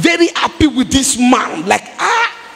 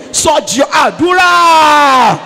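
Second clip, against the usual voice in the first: fervent shouted prayer; 6 semitones higher than usual. Real, not acted. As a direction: cry out to God with full force.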